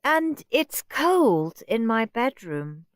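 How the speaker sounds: put-on high, slow, sulky child whine; moody whine. Margaret complaining to Mum about her room, high sulky whine. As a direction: drag it out like a spoilt guest. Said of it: "said Moody Margaret".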